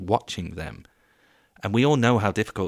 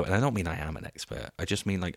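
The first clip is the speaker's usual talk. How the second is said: ordinary delivery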